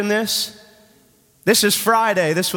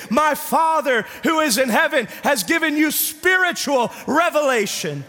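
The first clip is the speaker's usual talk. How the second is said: high, ringing preacher's cry; preaching at full force; 8 semitones higher than usual. Acted. Preacher shouting Peter's declaration to the congregation, fervent. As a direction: proclaim it with full fervour.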